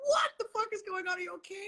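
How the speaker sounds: worried rush. Retold or imitated. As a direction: blurt out in worry. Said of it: "I'm like".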